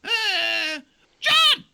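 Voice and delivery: a shrill cartoon doe; high-pitched doe voice; squeaky deer voice.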